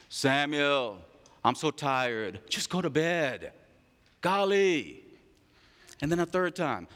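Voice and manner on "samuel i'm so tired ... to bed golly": low, soft, weary grumble; tired old Eli. Acted. Old Eli, worn out, sending Samuel to bed. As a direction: sigh it out, half asleep.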